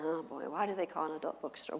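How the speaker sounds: quick, quiet muttered echo; inner panic. Retold or imitated. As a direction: rush the question to yourself under your breath.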